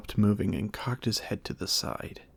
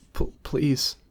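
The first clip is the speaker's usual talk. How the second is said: frightened stammer, higher; 5 semitones higher, 5 dB louder than usual. A narrator's reading. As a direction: plead through fear. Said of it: "Maga stammered".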